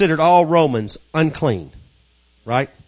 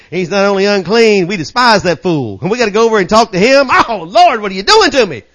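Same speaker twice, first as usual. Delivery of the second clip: hammed-up complaint, rising louder and higher; mock indignant protest; 6 semitones higher, 8 dB louder than usual. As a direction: play an exasperated objector crying out to the Lord.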